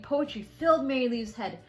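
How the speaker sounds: plain reading voice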